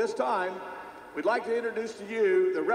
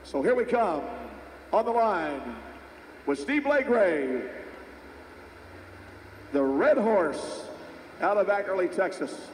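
live play-by-play; slower than usual. Real, not acted. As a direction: call the run as it happens.

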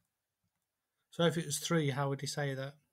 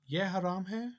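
"Ye haram hai" raised, rising on hai; questioning intonation; 5 semitones higher than usual. Shown as a demonstration. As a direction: ask "Ye haram hai" with a lifted ending. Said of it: "I'm asking the question".

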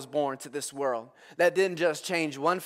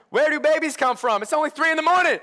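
higher and louder, incredulous; a tired parent at three in the morning; mock outrage at the hour; exasperated dad; 9 semitones higher, 6 dB louder than usual. Child's 3 a.m. question, dad's retort.

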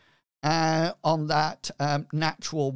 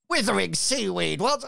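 pirate captain voice; 5 semitones higher than usual. Acted.

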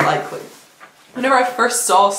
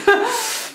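short high-pitched giggle. Real, not acted. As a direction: let a bright giggle out. Laughing at own hair.

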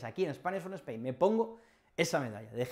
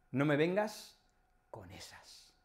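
fading to a whisper; 4 dB quieter than usual. Shown as a demonstration.

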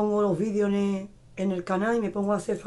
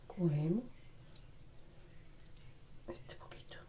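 whispering; 3 semitones lower, 15 dB quieter than usual. Real, not acted. Demonstrating hair, drops voice.

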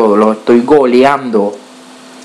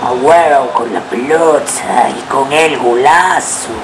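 commentator goal call; 2 semitones higher than usual. Acted. Gamer celebrating his goal in commentator voice.

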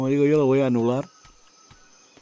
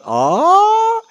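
high, loud, drawn-out "ah" of discovery; real astonished cry; delighted gasp; 6 dB louder than usual. Real, not acted. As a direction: let out a long high squeal of amazement. Discovers free cannons in game.

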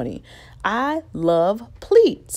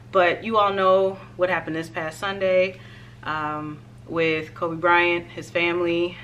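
ordinary talk, nothing marked

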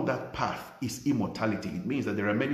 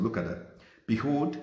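ordinary delivery

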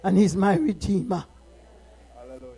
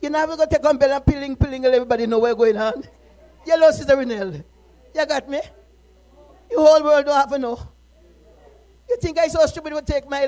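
scolding; 4 semitones higher, 7 dB louder, faster than usual. Real, not acted. Preacher scolding the congregation.

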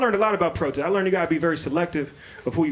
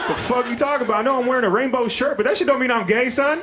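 pitched up in comic indignation; indignant protest; 7 semitones higher than usual. Retold or imitated. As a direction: object hotly, voice riding high.

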